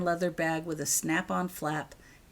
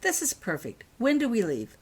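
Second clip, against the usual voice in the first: much higher, gleeful and bright-eyed; happy; 9 semitones higher, 4 dB louder than usual. Acted. Girl showing mum the hidden cat, gleeful. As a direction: show off the trick with glee. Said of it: "holding up the bag happily".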